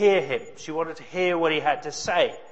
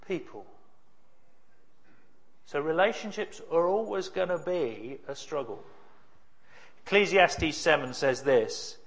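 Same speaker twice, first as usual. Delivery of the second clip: normal talking voice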